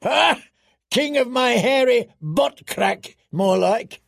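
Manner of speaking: high, scoffing bellow; roaring. A narrator's reading. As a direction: roar it out with mocking scorn. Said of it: "Tormund roared".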